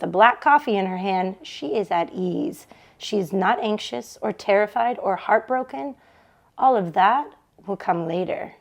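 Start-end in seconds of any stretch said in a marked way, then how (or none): none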